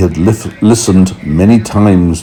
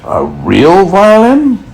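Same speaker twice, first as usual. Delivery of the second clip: surprised cry; 11 semitones higher, slower than usual. A narrator's reading. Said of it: "the old grasshopper cried".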